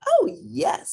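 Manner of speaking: high eager pig voice. A narrator's reading. First pig answering the wolf, high and eager. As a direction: chirp it like a little pig. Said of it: "said the first little pig".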